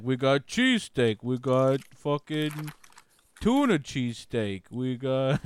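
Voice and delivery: slow, drawn-out sales pitch; lightly hammed-up deli guy; mock shop clerk. Deli clerk listing cheesesteaks to a customer, slow mock pitch. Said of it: "It's like".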